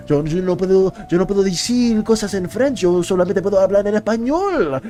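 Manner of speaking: deliberately clumsy Spanish accent, lines said in Spanish; hammy schoolbook Spanish accent; poor Spanish accent. Shown as a demonstration. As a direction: fake a bad Spanish accent on purpose. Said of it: "In a very poor Spanish accent".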